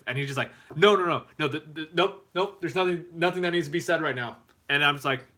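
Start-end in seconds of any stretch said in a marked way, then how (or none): 0.7-4.4 high-pitched, insistent mock refusal; imitated upset. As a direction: cut them off like an upset player. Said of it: "you got really upset".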